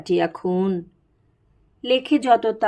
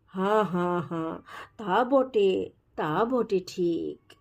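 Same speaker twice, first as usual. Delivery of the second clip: mock-solemn agreement; 2 semitones lower, 7 dB quieter than usual. A narrator's reading. Crowd solemnly agrees with Nanda's excuse.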